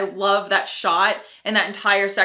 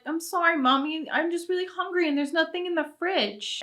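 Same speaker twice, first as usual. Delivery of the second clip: higher, softer imitation of a small boy; little boy voice; 8 semitones higher, 5 dB quieter than usual. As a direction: play the meek, hungry little kid. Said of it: "poor little Samuel is like".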